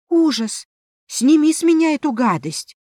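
high, loud frightened plea; panicked. A narrator's reading. Heroine begs to be rid of the ring, panicked. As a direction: cry out in alarm, voice jumping up. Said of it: "в панике промолвила".

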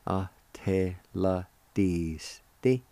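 "Ateladisti" very slow, every syllable drawn out; slow sounding-out. Shown as a demonstration. Teacher showing how join is pronounced, slow sounding-out. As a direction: stretch Ateladisti into measured syllables. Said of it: "It's pronounced like this".

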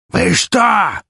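raspy furious shout, high and loud; hoarse yell. A narrator's reading. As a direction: roar it out hoarse. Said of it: "хрипло заорал".

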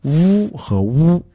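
first "乌" a toothy v buzz, second a rounded oo; slow contrast of v and w on "乌和乌"; v versus w. Shown as a demonstration. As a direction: keep the two "乌" clearly apart, v then w. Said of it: "是分开的".

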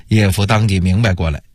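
ordinary delivery, nothing marked

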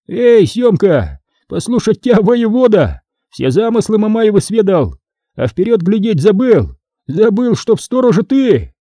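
high-pitched scolding yell. A narrator's reading. Foma to Syomka for forgetting to look ahead, yelling. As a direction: yell a mocking reproach at the commander. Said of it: "вздохнул поглубже и заорал".

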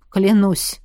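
falling, muted oath; dull, muffled. A narrator's reading. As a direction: repeat it heavily, muted. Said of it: "повторил он глухо".

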